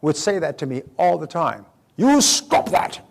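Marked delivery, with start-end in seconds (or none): 2.0-3.0 much higher, louder, drawn-out British scolding; father's British bellow. Retold or imitated. Father telling off his young children, loud British-accented scolding. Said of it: "with his British accent".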